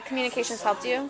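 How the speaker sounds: normal talking voice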